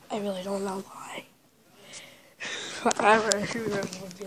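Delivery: soft secretive whisper. Real, not acted. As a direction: drop to a whisper. Hushed aside.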